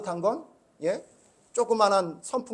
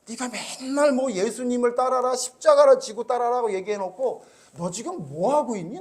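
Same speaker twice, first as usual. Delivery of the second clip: God's rebuke; 5 semitones higher than usual. Retold or imitated. God confronting the pastor about his sermons, pointed. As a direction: hold him to his own preaching. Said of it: "이런 생각이 드는 거예요".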